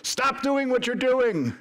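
high, drawn-out scolding call; mock street preacher. Someone standing on the subway, mock yelling. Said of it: "like someone standing on the subway".

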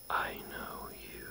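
slow, voiceless breathy whisper. Retold or imitated. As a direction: breathe each word slowly like a spirit. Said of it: "It's like a breathy whisper".

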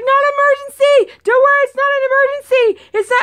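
reassuring husband it's no emergency, high and loud; reassuring shout. Retold or imitated. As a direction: call out fast to calm him. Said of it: "so that he doesn't panic".

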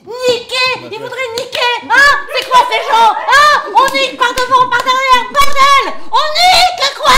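loud high yelling, building to a shout; ear-splitting comic shouting. Acted. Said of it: "je te pète pas trop les oreilles".

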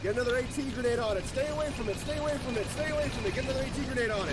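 high urgent shout; grenade warning yell. Real, not acted.

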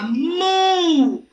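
"mu" slowly drawn out; sílaba modelo. Shown as a demonstration. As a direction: hold the vowel of "mu" for learners. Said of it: "me da como sílaba". ("mu" lento y alargado)

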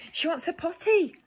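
high alarmed cry. Acted. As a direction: call out in alarm. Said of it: "cried the cook".

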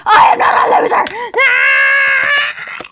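high shouted denial then scream; indignant shout. Acted. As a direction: yell it back, outraged. Acted outburst at taunt.

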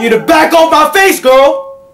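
high, loud sassy warning; mock sass. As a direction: give the baby attitude.

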